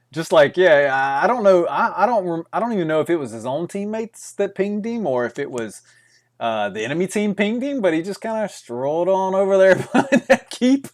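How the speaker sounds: loud, high, excited retelling. Real, not acted. Excited about a player's yolo.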